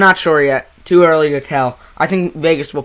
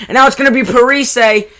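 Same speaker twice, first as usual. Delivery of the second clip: excited; 11 semitones higher, 10 dB louder than usual. Acted. Chance on the rush.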